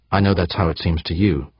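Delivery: usual speaking voice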